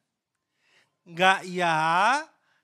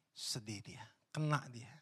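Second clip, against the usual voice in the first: hushed, breathy whisper, voice dropped low; dramatic whisper; 8 semitones lower, 12 dB quieter than usual. Acted. Preacher whispering to the congregation about Simon's grief. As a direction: lean in and whisper it like a secret.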